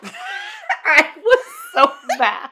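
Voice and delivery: very high, slow, laughing through words; laughing fit. Real, not acted. Hosts crack up over the cow scene, laughing high. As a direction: squeak it out mid-laugh. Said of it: "laughing fit".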